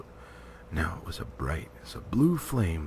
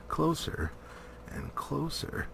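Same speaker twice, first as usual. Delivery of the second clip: fading to hush; 6 semitones higher, 6 dB quieter than usual. A narrator's reading. Suspense building.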